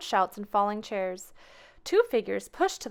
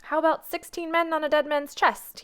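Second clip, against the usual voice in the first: higher and louder, hearty bellow; roaring old sea captain; 7 semitones higher, 5 dB louder than usual. A narrator's reading. Mister Whiskers proposing a sea song at the table, roaring. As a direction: boom out the sea shanty title jovially. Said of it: "he roared".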